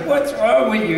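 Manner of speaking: high, mock incredulous brother; a retold high disbelieving older brother; exasperated brother. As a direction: throw it at him like he's crazy. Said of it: "he goes".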